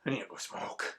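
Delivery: whispered under the breath; muttered aside. Real, not acted. Deflated after the rant, whispered. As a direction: whisper it to yourself, drained. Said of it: "Pisses me the fuck off".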